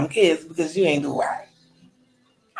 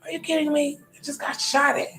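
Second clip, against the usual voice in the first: incredulous; 9 semitones higher, 3 dB quieter than usual. Retold or imitated. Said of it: "look at her like she was crazy".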